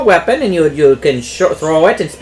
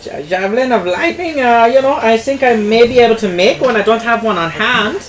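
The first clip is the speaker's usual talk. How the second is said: higher put-on merchant voice; shopkeeper NPC; 7 semitones higher than usual. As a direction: greet the customer as a chatty shopkeeper. Shopkeeper NPC answers customer.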